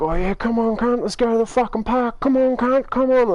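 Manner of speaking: high-pitched mock fighting taunts; riled-up rival egging them on; acted taunting. Rival group taunting for more fight.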